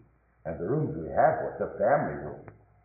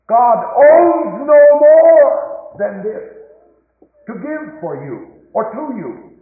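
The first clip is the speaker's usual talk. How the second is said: very high, loud and slow proclamation; soaring preaching shout; 12 semitones higher, 15 dB louder, much slower than usual. Real, not acted. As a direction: stretch every word out at the top of your voice. Climax of sermon.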